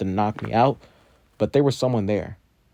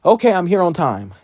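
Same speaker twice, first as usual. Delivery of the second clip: much higher, loud, panting arrival; out-of-breath kid calling out to the class; relieved and triumphant; 9 semitones higher, 8 dB louder than usual. Acted. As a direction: burst in proud of making it. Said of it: "he says".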